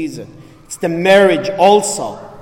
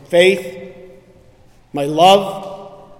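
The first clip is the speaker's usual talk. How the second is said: usual speaking voice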